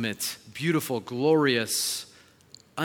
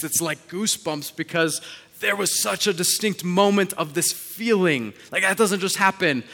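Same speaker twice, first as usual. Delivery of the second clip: louder, higher, building sermon passion; preaching at full force; 5 semitones higher, 8 dB louder than usual. Acted. Pastor telling the church about answered prayer, preaching loud. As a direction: drive it home to the congregation, rising loud.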